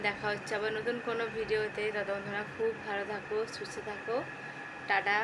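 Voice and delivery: talking through clenched teeth from toothache, soft and careful; quiet pained words pushed through tight teeth. Real, not acted. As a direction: keep the jaw shut and speak gently, it hurts. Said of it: "দাঁত চেপে চেপে কথা বলছি".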